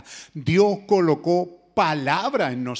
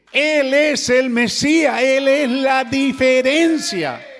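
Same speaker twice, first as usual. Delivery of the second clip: proclaiming louder and higher; 5 semitones higher, 5 dB louder than usual. Acted. Clímax de predicación.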